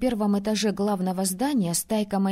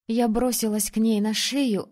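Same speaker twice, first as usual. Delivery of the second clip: ordinary delivery, nothing marked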